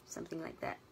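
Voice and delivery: ordinary delivery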